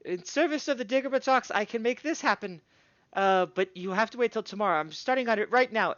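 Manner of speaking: gnome smith voice. Acted. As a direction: chatter on like a busy artisan. Smith sets price.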